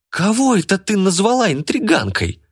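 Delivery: very high-pitched indignant cry; outraged yell. A narrator's reading. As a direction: shriek the question as if insulted. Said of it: "завопила Ирина". (возмущённый вопль)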